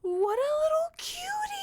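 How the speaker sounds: squeaky cooing; very high, loud pet voice. Real, not acted. Cooing over a hamster.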